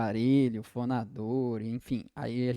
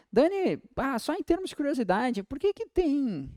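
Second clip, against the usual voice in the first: higher-pitched student piping up with a question; curious student; 10 semitones higher than usual. Acted. Student asking the teacher out of curiosity, high and questioning.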